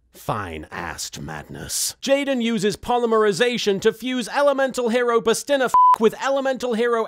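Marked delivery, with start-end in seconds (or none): 0.1-2.0 deep slow voice. Pretend. Relishing a favourite card.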